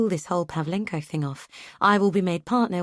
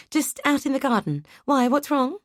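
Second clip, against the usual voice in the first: higher-pitched, anxious spouse voice; Sal, worried; 8 semitones higher than usual. Acted. Sal answering the phone asks what's wrong, higher and anxious. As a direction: catch breath and ask what's wrong.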